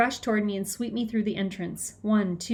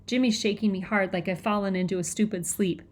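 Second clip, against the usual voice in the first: plain reading voice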